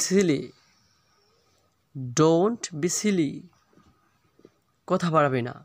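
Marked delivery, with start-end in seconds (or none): 2.2-3.3 slow, stretched-out model reading; slow repeat. Shown as a demonstration. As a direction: draw out "Don't" and "silly" for learners. Teacher repeats phrase slowly.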